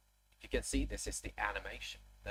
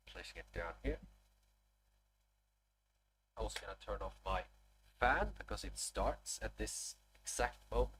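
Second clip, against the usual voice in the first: usual speaking voice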